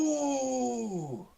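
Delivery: high drawn-out call, falling at the end; held yell. Acted. Player yelling out the hound's name, high drawn-out call. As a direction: call out long and high without much force. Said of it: "yell out".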